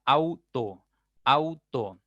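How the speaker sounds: "auto" in two beats, au, to; syllable by syllable. Shown as a demonstration. Teacher introducing the next word, "auto" split au, to. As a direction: keep au joined, then a separate to in "auto". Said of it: "al momento de separar esta palabra diré".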